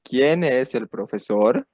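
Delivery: "profesor" rises at the end; rising question intonation. Shown as a demonstration. Teacher has learners repeat after him, rising question intonation. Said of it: "that intonation goes up at the end".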